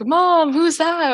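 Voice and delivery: put-on high, drawn-out child's question; imitated kid. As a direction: imitate a kid pestering mom, slowly. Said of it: "this kid was like".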